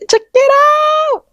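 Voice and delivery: excited shout. Real, not acted. Showing her new piercing to viewers, excited high shout. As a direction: belt it out like showing off a prize.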